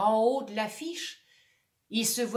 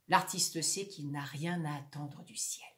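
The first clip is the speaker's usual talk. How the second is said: whisper; 7 semitones lower, 6 dB quieter than usual. Acted. Whispered narration aside.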